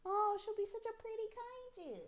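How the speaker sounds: very high, soft adoring coo. Real, not acted. Mom admiring her little kaiju, in high baby talk.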